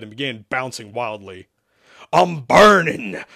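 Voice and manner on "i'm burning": loud, drawn-out, higher-pitched howl; roaring. A narrator's reading. Demon scalded by holy water, roaring. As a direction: howl it slowly in agony. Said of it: "Isaac roared".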